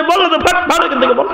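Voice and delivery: put-on high, impatient snap at the boy; preacher losing patience with a child; exasperated retort.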